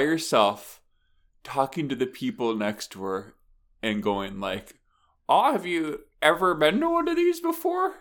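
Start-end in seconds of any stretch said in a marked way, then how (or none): 5.3-7.9 chatty concertgoer. Retold or imitated. Woman asking the people next to her, high chatty voice. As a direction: imitate her chatting, pitched way up.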